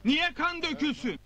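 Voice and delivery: calling out to the crowd; rousing speech. Acted. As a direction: whip up the crowd. Rallies crowd, calls for agreement.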